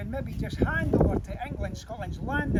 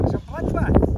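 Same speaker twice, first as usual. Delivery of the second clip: loud dramatic declaration; 3 semitones higher, 8 dB louder than usual. Acted. Climax of massacre telling.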